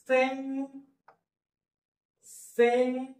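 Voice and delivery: Saint drawn out on a high held note; high, slow sound-out of Saint; broken-up slow pronunciation. Shown as a demonstration. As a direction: stretch Saint, detaching the final ट. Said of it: "फोडून फोडून उच्चार लिहितो आणि हळूहळू".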